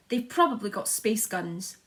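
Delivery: ordinary delivery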